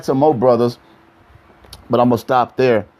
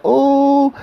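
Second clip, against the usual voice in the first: mocking gasp; 12 semitones higher than usual. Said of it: "And I was like".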